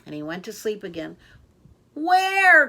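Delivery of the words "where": loud high cry. A narrator's reading. Katie crying out to the sleepy owl. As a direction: shout it up at him. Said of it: "cried Katie".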